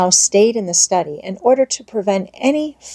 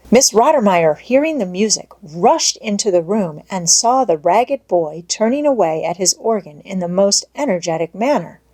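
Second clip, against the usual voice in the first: plain reading voice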